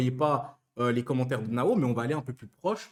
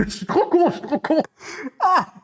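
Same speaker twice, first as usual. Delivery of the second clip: high giggling self-mockery; laughing at himself; 12 semitones higher, 5 dB louder than usual. Real, not acted. Streamer laughing at his own silly joke, high giggle.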